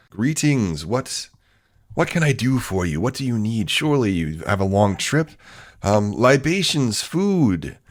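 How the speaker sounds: high-pitched, gracious gentleman host; effusive party host. Acted. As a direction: fuss over newly arrived guests. Host greets the adventurers.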